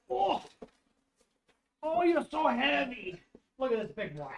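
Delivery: calling to the dogs from across the room; away from the mic. Real, not acted.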